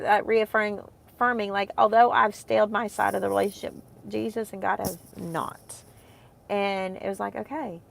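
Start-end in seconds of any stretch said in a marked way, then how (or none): none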